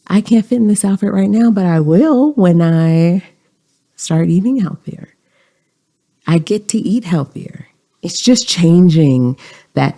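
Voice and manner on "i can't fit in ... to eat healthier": higher, hopeful reframe of the thought; upbeat self-talk. Shown as a demonstration. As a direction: cheer yourself on instead of scolding. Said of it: "or maybe it's".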